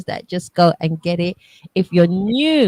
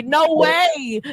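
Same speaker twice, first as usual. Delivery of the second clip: high mock disbelief; retold UK listeners; 10 semitones higher than usual. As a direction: imitate their reaction, pitched way up.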